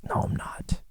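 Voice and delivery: hushed, breathy no I'm not; mock-guilty whisper; whispered denial. Imagined dog owner replying.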